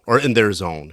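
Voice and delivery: normal talking voice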